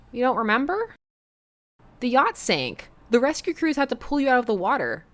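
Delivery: lightly voiced teen. [name] explains the shipwreck.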